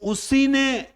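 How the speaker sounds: high, loud, slow insistence; insisting. Acted. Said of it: "वो झूठ बोल रहा है".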